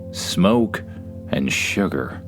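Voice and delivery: usual speaking voice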